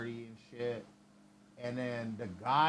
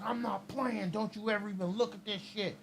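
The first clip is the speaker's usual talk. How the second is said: imitating a high-pitched stern warning; stern lecture; 7 semitones higher than usual. Meth head warning the teenager off meth, higher stern lecture. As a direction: scold the kid like a wired older guy.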